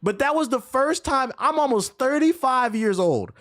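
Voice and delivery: high-pitched, emphatic disbelief; incredulous. Real, not acted. Streamer recounting a word he never heard in real life, incredulous. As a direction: protest in real amazement, pitch held high.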